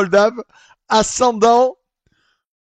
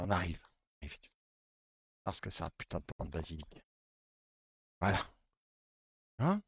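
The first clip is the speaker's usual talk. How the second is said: hushed voice; 7 semitones lower, 11 dB quieter, faster than usual. Real, not acted. Goes to garden at night.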